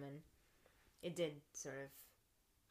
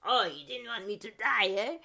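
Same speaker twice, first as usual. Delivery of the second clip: hammed-up bad French accent, louder; terrible French accent; 3 semitones higher, 11 dB louder than usual. Imagining her old French teacher hearing this, in a bad French accent. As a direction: play the old French clown master with a clumsy French accent. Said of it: "sorry for the terrible French accent".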